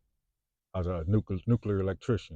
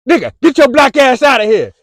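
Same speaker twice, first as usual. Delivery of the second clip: screaming, much higher and louder; huge shout; 19 dB louder than usual. Acted. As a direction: blast the thought out with a yell. Shouted dismissal of thoughts.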